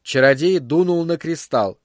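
plain reading voice

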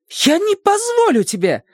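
high, indignant cry; indignant outburst. A narrator's reading. Wife refusing her husband's insults, indignant and high.

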